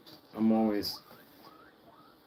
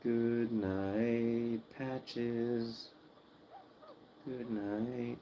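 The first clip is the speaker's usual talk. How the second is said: "Good night" much softer, hushed; hushed "Good night, Patches" as the pig dozes off; 7 dB quieter, much slower than usual. Real, not acted. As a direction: ease "Good night" out gently to a sleepy pet. Soft goodnight to the sleepy pig.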